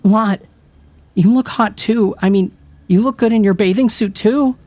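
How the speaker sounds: usual speaking voice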